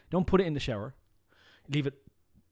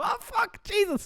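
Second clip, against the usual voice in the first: shocked exclamation; slower than usual. Acted. Guard reacts in joke.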